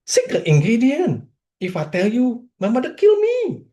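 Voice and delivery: put-on very thick Vietnamese accent, very high-pitched; Long. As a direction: imitate a Vietnamese friend pitching a sale, high voice. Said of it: "in this very thick Vietnamese accent".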